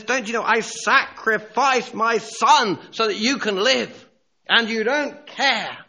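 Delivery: high, loud outcry from a bereaved father; anguished shout. Acted. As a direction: shout the accusation, slowing at the end. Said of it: "And he shouts to them".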